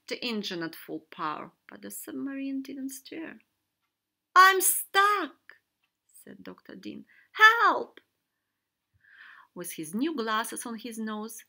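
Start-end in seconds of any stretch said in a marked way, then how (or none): 4.3-5.6 high, very loud call of distress; loud distressed shout; alarmed cry. A narrator's reading. Trapped doctor calls for help. 7.3-8.0 high, very loud shout; piercing distress call; cry for help. A narrator's reading. Trapped doctor calls for help.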